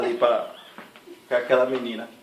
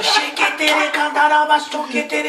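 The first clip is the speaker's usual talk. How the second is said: high, slow mock tongues chant; mock tongues-speaking; 12 semitones higher, 6 dB louder, much slower than usual. Imitating the pastor praying in tongues over him. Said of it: "E ela assim, ó".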